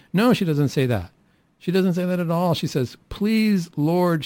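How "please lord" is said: insistent plea. Acted. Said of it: "she insisted".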